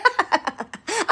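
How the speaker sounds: high gloating chuckle; short mocking cackle; taunting laugh.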